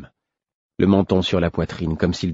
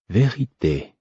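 dazed; 3 semitones higher, slower than usual. A narrator's reading. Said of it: "comme hébété".

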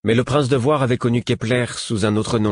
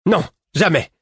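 higher, forceful refusal; violent cry; 6 semitones higher, 4 dB louder than usual. A narrator's reading. Woman rejecting the group's defeat, crying out violently. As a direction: cry out in fierce denial. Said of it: "s'écria la femme avec violence".

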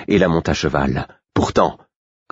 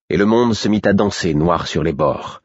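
plain reading voice

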